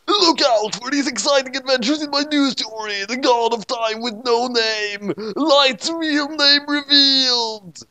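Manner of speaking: high, slow movie trailer announcement; mock trailer voice. Author plugs the next story, high slow trailer voice. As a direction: hype it like an ad.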